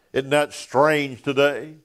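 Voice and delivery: normal talking voice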